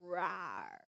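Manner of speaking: low playful mock roar; tiger roar. Shown as a demonstration. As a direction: growl like a tiger to show the child. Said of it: "What animal is this?".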